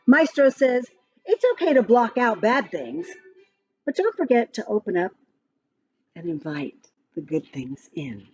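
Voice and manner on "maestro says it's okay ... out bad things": plain reading voice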